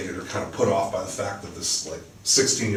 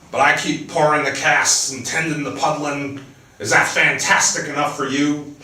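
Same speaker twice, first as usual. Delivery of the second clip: livelier, higher reading voice for the book; dramatic novel reading, louder and higher than her chat; story narration; 4 semitones higher, 5 dB louder than usual. A narrator's reading. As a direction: read the scene aloud like an author at a reading. Author reads her novel aloud.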